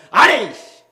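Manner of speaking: loud, high mock huff of anger; huffing grumble. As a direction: huff like a sulking prophet. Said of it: "씩씩거리면서". (씩씩거리며 크게 투덜대듯이)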